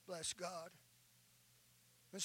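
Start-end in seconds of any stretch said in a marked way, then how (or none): none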